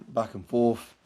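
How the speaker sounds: ordinary talk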